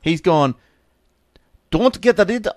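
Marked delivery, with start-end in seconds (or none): 1.7-2.5 a quick, loud, badly faked Indian accent; mock-Indian, louder, with a laugh. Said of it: "that sounds like Indian".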